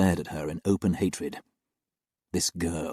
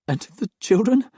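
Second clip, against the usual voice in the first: choked voice; 14 semitones higher, 6 dB louder than usual. Acted. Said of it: "asked in a choked voice".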